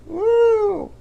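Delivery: high-pitched, sustained whoop; celebratory woo. Real, not acted. Host wishes a happy pride, high celebratory woo. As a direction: cheer with a long high "woo".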